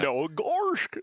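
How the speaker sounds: goofy cartoon "gorsh". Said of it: "You sound like Goofy".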